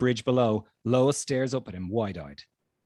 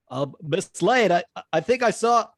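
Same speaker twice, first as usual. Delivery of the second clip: flustered Jimmy; 8 semitones higher, 6 dB louder than usual. Acted. Jimmy rushes up to Lois.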